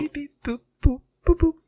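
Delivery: toy robot voice. Pretend. Acting out a voicemail beep.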